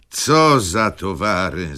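high, drawn-out exclamation; called out. A narrator's reading. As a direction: call it out high and slow. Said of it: "zawołał Wokulski". (głośny, przeciągły okrzyk)

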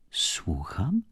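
soft, flustered whisper; embarrassed, hushed question. A narrator's reading. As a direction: caught off guard, barely voiced. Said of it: "zakłopotał się duchowny".

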